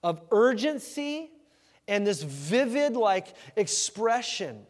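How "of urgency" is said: urgency slowed and lifted, stressed hard; urgent stress. Shown as a demonstration. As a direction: lean into urgency. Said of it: "give it that nuance of urgency".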